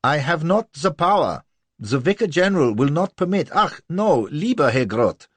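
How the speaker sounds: high, loud, flustered pleading; protesting little man. A narrator's reading. Courtier fleeing Lola's rage, high and flustered. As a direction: panic as the crockery flies.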